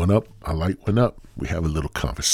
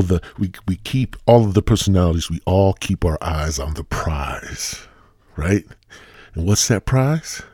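normal talking voice

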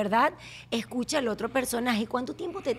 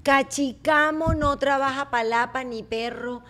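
slow, drawn-out read-aloud; reading out slowly; slower than usual. Real, not acted. Host reading the guest's written saying, slow deliberate reading. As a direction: sound out the phrase word by word.

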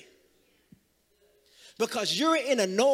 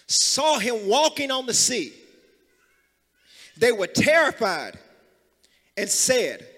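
loud, raised preacher's proclamation; full-force preaching; 6 semitones higher, 7 dB louder than usual. Real, not acted. Preacher proclaiming Jesus' word to the congregation, full-force preaching. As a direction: proclaim it to the whole room, punching key words.

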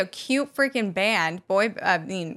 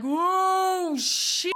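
mock alarmed singer; 8 semitones higher than usual. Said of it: "he was like".

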